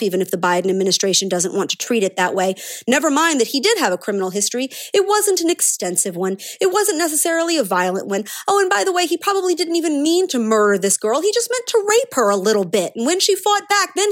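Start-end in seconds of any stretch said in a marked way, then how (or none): 4.9-14.1 high-pitched mock defense; sarcastic minimizing. Commentator mimicking media downplaying a killing, bitterly sarcastic. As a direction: parody an apologist playing it down.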